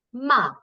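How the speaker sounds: "ma" falling sharply as Mandarin fourth tone. Shown as a demonstration. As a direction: drop "ma" hard from high. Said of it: "fourth tone".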